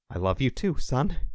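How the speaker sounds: understated choked-up father; a touch of emotion catching in a dad's reply. A narrator's reading. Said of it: "Tom choked".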